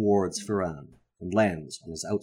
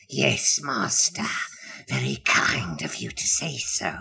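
hunchback assistant. Acted. Imp answers the wizard.

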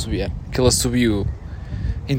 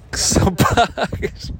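laughing; 8 semitones higher, much slower than usual. Real, not acted. Mocking the paid sunbeds.

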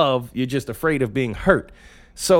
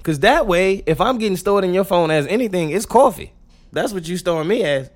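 ordinary delivery

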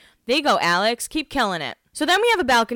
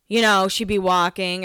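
ordinary delivery

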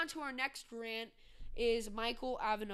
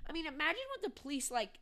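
ordinary delivery

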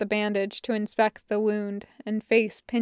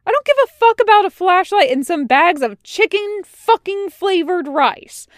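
high, loud, heated rant; angry snarl; 9 semitones higher, 9 dB louder than usual. A narrator's reading. Tucker clambers out to face Wash, snarling angrily. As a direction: rant back at him furiously. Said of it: "Tucker snarls right back".

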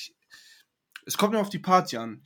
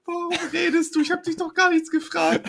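hoher, spöttischer Tonfall; mocking himself high; 13 semitones higher than usual. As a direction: ahm sich selbst hoch nach. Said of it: "hab ich gesagt". (sich selbst nachäffen, hoch und lachend)

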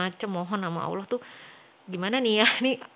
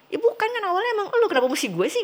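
high, fast, louder protest; 12 semitones higher, 5 dB louder, faster than usual. Retold or imitated. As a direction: argue back, indignant and pitched up. Her own protest in argument. (protes keras, nada tinggi dan cepat)

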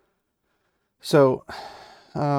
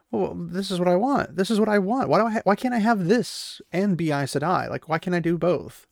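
higher, torn protest, acted out; mock pleading; 6 semitones higher than usual. Egwene torn between husband and Aes Sedai, mildly hammed-up pleading. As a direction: retell it as a plaintive plea. Retold anguished protest at a hard choice.

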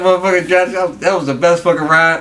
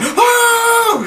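laughing mock shriek, much higher; imitated scream. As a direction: shriek like the girl in the video, laughing. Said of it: "she's like".

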